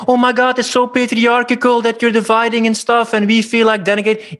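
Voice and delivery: high, sneering parody of an offended attendee; high mocking voice of a woman scandalised by the men's circle; mocking outraged woman. Mocking outraged course participants.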